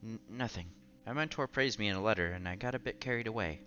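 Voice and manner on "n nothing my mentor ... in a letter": ordinary talk, nothing marked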